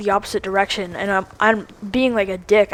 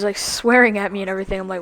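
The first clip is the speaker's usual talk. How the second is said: normal talking voice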